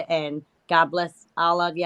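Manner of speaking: ordinary talk, nothing marked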